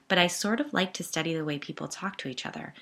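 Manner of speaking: usual speaking voice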